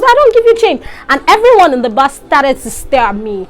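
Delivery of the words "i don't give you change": high, sneering imitation of the conductor; mocking conductor. Imitating the rude bus conductor's refusal, lightly sneering. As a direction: parrot his rude refusal back in a higher voice. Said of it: "I was so pissed off".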